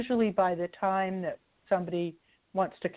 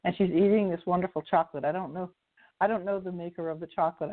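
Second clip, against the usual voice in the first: usual speaking voice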